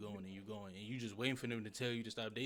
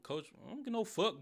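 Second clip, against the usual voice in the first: retold protest, pitched way up; mock defiant retort; 8 semitones higher, 4 dB louder than usual. Acted exchange with coach over cramping, mock defiant. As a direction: imitate a heated refusal, higher voice.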